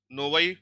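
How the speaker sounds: नोवाई with the unstressed o reduced to a, "na-vai"; Russian akanye on नोवाई. Shown as a demonstration. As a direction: say नोवाई with a short a sound in the first syllable. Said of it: "नोवोई नहीं पढ़ेंगे ना".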